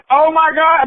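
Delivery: put-on high, loud shocked gasp of a kid; a young fan's stunned cry. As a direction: blurt it out in disbelief. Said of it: "he's like".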